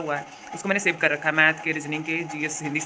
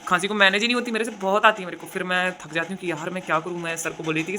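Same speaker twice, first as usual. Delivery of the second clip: ordinary talk, nothing marked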